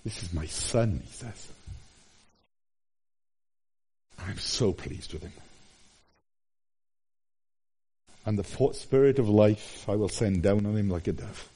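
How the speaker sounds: soft, low, hushed voice of God the Father; tender Father's voice. Acted. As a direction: speak it gently, like a proud father over his son. God the Father speaks at baptism.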